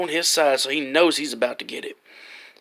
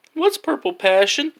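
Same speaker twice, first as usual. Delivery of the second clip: put-on flinching kid; 9 semitones higher than usual. Flinched back like she's about to hit him.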